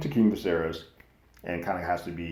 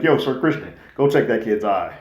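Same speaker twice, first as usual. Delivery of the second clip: calling out; 5 semitones higher, 9 dB louder than usual. Retold or imitated. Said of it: "Alicent was like".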